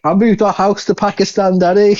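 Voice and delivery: a hint of a whiny son quoting the film; quoting a cult comedy to friends, lightly put-on; movie quote. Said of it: "parts of it ... I just quote".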